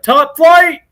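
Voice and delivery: high, loud catchphrase call; mock rallying shout. Greeting the guard already sitting there, mock rallying shout. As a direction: holler it like a comic battle cry.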